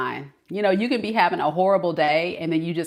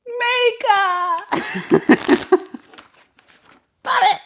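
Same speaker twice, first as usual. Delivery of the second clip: high, drawn-out whine of a name; whiny Cancer fan; 5 dB louder, slower than usual. Retold or imitated. A Cancer viewer whining at Mika, high. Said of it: "I know Cancer's like".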